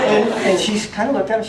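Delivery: normal talking voice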